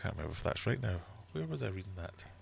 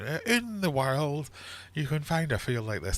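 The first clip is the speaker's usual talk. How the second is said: hint of an Attenborough narration; nature documentary; 9 semitones higher than usual. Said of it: "David Attenborough or someone like that".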